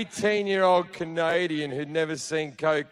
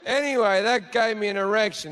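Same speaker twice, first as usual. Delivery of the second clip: ordinary talk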